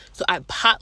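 ordinary delivery

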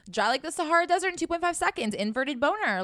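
hyped joke burst; comic outburst. Real, not acted. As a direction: rattle it off in mock alarm. Comic exaggeration.

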